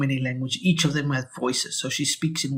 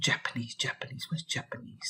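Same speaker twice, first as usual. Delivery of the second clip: near-whispered, much softer, to himself; muttering while searching; 11 dB quieter than usual. Real, not acted. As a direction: hunt through a list under your breath.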